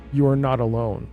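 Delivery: usual speaking voice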